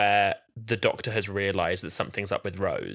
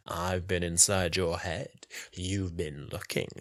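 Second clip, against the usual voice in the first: lecherous tease; 4 semitones lower, slower than usual. Retold or imitated. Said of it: "He's like".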